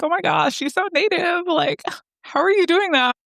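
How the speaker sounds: high-pitched, gushing mock amazement; playfully over-amazed, voice way up high; silly first-time mom. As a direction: gush like an awestruck new parent. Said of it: "me just being like, a silly, like, first-time mom".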